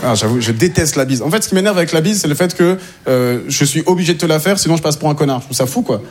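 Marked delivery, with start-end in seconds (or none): none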